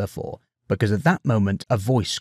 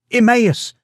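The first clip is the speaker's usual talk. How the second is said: true voice; 13 semitones higher, 7 dB louder than usual. A narrator's reading. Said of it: "no sign of creaking".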